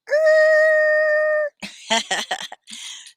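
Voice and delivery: long high delighted laugh; big high giggle. Real, not acted.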